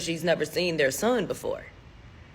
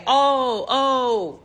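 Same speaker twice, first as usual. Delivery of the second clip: loud, raised, drawn-out ohs; knowing realization; 5 semitones higher, 4 dB louder than usual. Retold or imitated. Parents answering her questions about the boy, imitated knowing ohs. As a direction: voice it like a put-on dawning realization.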